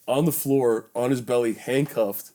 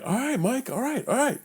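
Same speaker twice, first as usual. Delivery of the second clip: high, yielding reassurance; retold placating; 12 semitones higher than usual. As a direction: back down quickly, pitched way up. Giving in to manager, retold.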